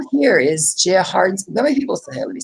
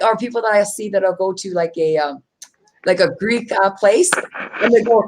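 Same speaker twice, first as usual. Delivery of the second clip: usual speaking voice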